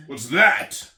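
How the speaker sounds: loud, sharp, high snap; snapping king. Acted. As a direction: bite the question off. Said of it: "snapped the king".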